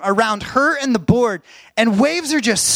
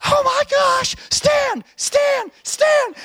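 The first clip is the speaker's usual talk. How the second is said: high excited yelling, much higher; screaming encouragement; much slower than usual. Retold or imitated. Dad screaming from the water for his daughter to stand. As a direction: shriek it across the surf to her. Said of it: "she could hear me screaming from back there".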